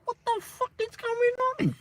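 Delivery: high, startled, held back; high-pitched disbelief under the breath; baffled. Retold or imitated. Baffled inner reaction.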